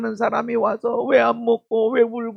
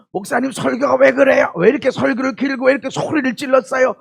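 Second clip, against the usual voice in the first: fast, raised whiny complaint to the pastor; mock-grumble at the pastor for a long, loud sermon; hammed-up churchgoer griping; complaining congregant; 4 semitones higher, 5 dB louder, faster than usual.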